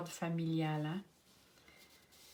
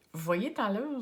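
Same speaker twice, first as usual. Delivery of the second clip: Quebec accent; 4 semitones higher, 6 dB louder than usual. Shown as a demonstration. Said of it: "j'ai pris mon petit accent québécois".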